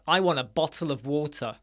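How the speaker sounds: normal talking voice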